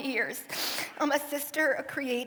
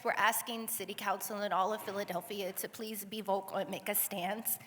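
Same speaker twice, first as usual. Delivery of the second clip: ordinary talk, nothing marked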